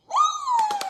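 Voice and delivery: high-pitched excited whoop; overexcited little whoop; excited woo. Real, not acted. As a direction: let out a high, gleeful "woo". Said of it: "you're just too excited".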